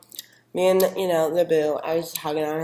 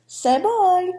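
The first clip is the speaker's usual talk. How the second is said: pet talk; 13 semitones higher, 6 dB louder than usual. Acted. Baby talk to the dog.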